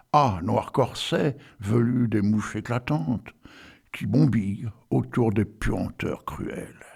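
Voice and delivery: slow, deliberate reading of verse; solemn poetry recitation; poem recital. Acted. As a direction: recite Rimbaud slowly, savouring each image. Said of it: "Alors, j'y vais, attention".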